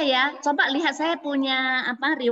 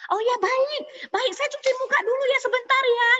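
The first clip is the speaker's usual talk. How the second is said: eager helper; 13 semitones higher than usual. Retold or imitated.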